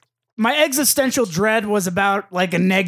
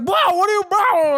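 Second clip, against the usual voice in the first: startled guy; 9 semitones higher than usual. Retold or imitated. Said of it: "he's like".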